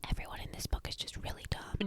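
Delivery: hushed, fast whisper aside; a conspiratorial whispered aside. Real, not acted. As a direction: mutter it under your breath, quickly.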